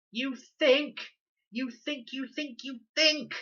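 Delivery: high, loud mocking repeat; furious repetition. A narrator's reading. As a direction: hammer the words back at her. Con furious at Leela.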